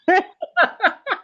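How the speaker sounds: a bright high laugh at her own joke. Real, not acted. Laughing at how easy her own cancer felt. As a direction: burst out laughing, pitch jumping up.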